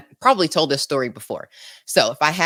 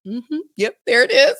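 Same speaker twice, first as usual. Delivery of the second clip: high-pitched mock agreement; imitating humoring friend; 7 semitones higher than usual. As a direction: play along as the friend, pitched up. Friend humoring mom, voiced in story.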